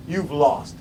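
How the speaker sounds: low, soft, final fall; dropped verdict. Real, not acted. Speaker giving listeners the consequence, low and final. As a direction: drop it down as a quiet conclusion.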